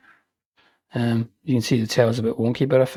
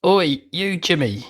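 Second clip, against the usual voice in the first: a hammed-up Scottish call, slow and higher; mock Scottish accent; 5 semitones higher, slower than usual. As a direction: playfully hail the figure like a Scottish bloke. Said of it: "Actually in a French accent".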